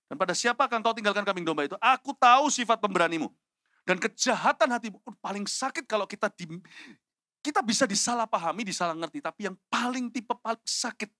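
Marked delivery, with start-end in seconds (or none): 0.1-5.0 loud, high scolding; angry accusation. Acted. Older brother accusing Daud of an evil heart, angry and loud. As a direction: accuse him with rising force. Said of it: "bangkitlah amarahnya pada Daud".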